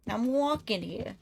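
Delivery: slow, hammed-up New York accent. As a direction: play a New York cabbie yelling at traffic in a New York accent, half-serious. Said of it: "I can't even do a New York accent".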